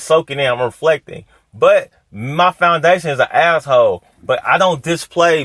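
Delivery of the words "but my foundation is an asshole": ordinary talk, nothing marked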